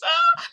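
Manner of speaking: high, loud squeal of excitement; excited squeal. Retold or imitated. Thrilled about spending the night together, excited squeal. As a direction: let out a giddy high-pitched shriek. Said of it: "I'm getting excited".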